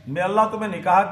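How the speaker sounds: ordinary delivery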